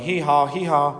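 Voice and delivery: a playful hee-haw bray; mock donkey hee-haw. A narrator's reading. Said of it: "and going".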